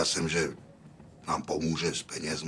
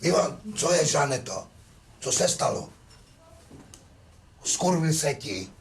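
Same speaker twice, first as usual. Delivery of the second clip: upset questions; 9 semitones higher than usual. Real, not acted. After the quarrel, demanding answers.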